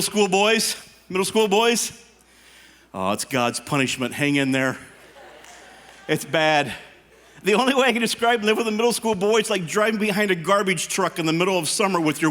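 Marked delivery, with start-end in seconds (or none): none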